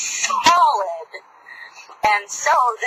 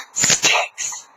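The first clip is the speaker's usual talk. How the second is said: usual speaking voice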